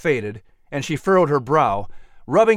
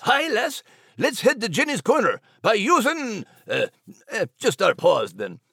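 higher Scottish brogue; hearty Scottish lilt; Scottish dog; 6 semitones higher than usual. Acted. Max the dog leads to Jenny's corner, Scottish brogue.

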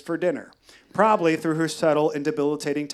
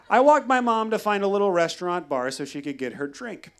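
normal talking voice

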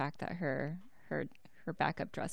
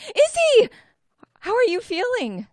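high, loud squeal of a tiny person; loud, high, excited little girl voice; squeaky Winley voice; 14 dB louder than usual. Acted. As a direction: call out to Izzy in a tiny shrill pitch.

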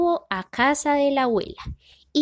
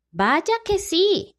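exclaiming; 8 semitones higher, 3 dB louder, much slower than usual. A narrator's reading. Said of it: "exclamó Amapola".

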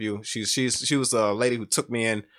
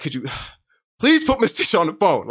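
louder, higher, urgent pleading; desperate plea; 5 semitones higher, 5 dB louder than usual. Retold or imitated. Shot teen begging his little sister on the phone, urgent plea. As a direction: beg her to hurry, barely holding it together. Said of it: "I got real desperate".

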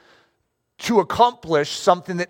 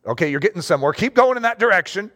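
ordinary talk, nothing marked

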